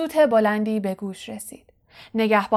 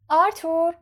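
higher-pitched call for attention; 7 semitones higher than usual. Acted. Mrs Weasley interrupts her husband from the train, calling out. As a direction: call over to your husband.

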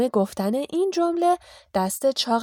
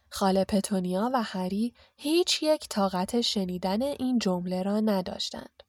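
usual speaking voice

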